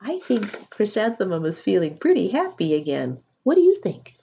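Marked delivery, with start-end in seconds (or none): 3.4-4.1 eager question. Real, not acted. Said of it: "asking the children".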